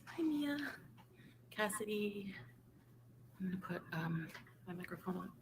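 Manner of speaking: faint, mic not on yet; quiet, far from the mic; barely audible. Real, not acted. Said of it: "I can barely hear her".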